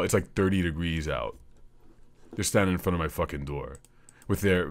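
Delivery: ordinary talk, nothing marked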